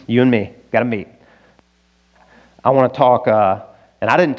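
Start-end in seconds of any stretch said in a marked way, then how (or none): 0.1-1.1 put-on a light Arkansas accent, lower; lightly voiced Arkansas pastor; half-joking Arkansan twang; mild Arkansan. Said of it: "Arkansan term".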